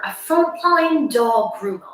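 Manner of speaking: slow, hammed-up TV intro; mock reporter announcement. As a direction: announce it slowly with mock gravity. Skit's reporter announcing the guest.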